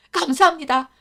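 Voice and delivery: put-on boy thanking the prince; grateful joy. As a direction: burst out in thanks.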